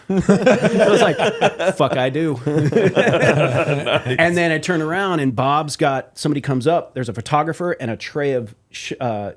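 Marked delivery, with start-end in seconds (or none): 0.1-5.2 slow, laughing through the words. Real, not acted. Telling hosts about the hug, slow laughing delivery. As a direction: crack up while telling it.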